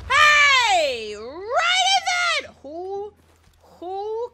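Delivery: loud, high, stretched hey; a real shocked shout; startled yell. Real, not acted. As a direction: yell out as it hits. Yelling as grenade hits.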